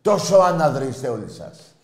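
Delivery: loud, high start that falls away; heated scolding of the whole crowd; angry accusation. Real, not acted. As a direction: hurl the insult, then let it drop. Insults listeners, then repeats it louder.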